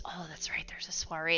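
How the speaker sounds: whisper. Real, not acted. Aside to herself while gaming.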